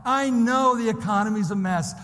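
preacher driving towards the big payoff; building sermon climax. Real, not acted. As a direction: pile up the phrases with mounting urgency. Preaches at full force.

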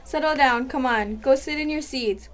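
a loud teacher settling the class; teacher calling out. Acted. Teacher tells noisy class to sit, loud. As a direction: call the class to order.

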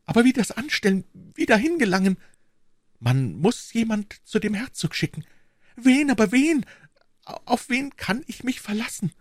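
high, agitated royal lady; distraught queen. Acted. Queen wonders whom she can send, distraught and high. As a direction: question urgently in a high, helpless voice.

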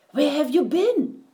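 high, loud eager question; excited. A narrator's reading. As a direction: burst out with eager joy. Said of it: "asked the fish excitedly".

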